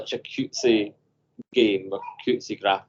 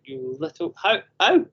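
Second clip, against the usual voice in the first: astonished; 7 semitones higher than usual. Retold or imitated. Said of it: "And I'm like".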